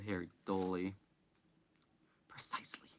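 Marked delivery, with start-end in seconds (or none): 2.3-2.9 nasty whisper. A narrator's reading. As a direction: hiss it quietly. Vernon whispering in story.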